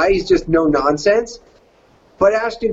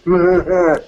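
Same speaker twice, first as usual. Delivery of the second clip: skater dude laugh. Shown as a demonstration. Actor adding a laugh to his skater voice, chilled chuckle. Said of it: "I threw in a laugh".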